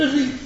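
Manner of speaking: ذ of الذين turned into z; Egyptian z for ذ. Shown as a demonstration. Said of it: "يقول". (الذال زاياً)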